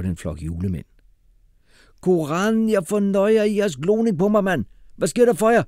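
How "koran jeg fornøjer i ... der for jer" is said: very high, loud indignant challenge; shouting street youth. A narrator's reading. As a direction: yell at the gawkers, pitched way up. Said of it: "råbte Kasim til nogle piger".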